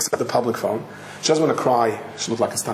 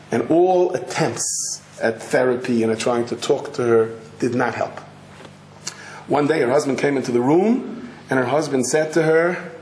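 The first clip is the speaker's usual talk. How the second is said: ordinary delivery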